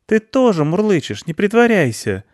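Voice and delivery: put-on very high child's voice; teasing girl. Girl catches cat pretending not to purr, high and teasing. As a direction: tease in a high girl's voice.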